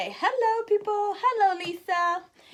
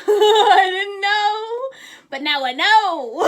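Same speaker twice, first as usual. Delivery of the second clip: laughing, higher and louder, stretched out; 5 semitones higher, 11 dB louder, slower than usual. Real, not acted. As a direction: laugh at yourself while admitting it. Laughing at her own pitfall.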